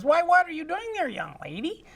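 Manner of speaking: playful granny voice; Granny Loomis. Acted. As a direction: squeak it out like a nosy old grandma. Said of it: "Granny Loomis comes in the room and says".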